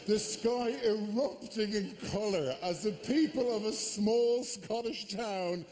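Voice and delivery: ordinary talk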